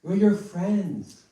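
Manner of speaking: high, pleading cry of the babies. Retold or imitated. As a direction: plead loudly like frightened infants. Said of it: "crying out".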